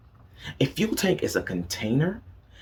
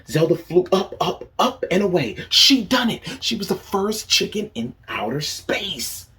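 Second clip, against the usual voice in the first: building higher and louder, soaring; excited narration; 8 semitones higher, 5 dB louder than usual. A narrator's reading. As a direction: cheer her up into the sky. Narrating the triumphant rocket launch.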